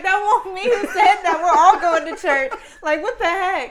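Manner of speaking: laughing squeaky cat voice; high giggly talking cat. Retold or imitated. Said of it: "The cat was like".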